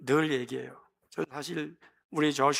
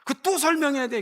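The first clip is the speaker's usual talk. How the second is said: exasperated parent; 9 semitones higher, 4 dB louder than usual. Retold or imitated. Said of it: "나 가끔 애들한테도 그러잖아".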